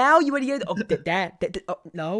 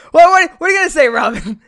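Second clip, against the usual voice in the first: loud mock challenge; 7 semitones higher, 8 dB louder, faster than usual. Mock confrontation with Robin.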